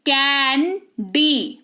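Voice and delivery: "कैंडी" split into a long "can", pause, long "dee"; "कैंडी" as two drawn-out, separate efforts; two separate syllables. Shown as a demonstration. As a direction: break "कैंडी" in two, very slowly. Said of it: "ये दो syllable हो गया".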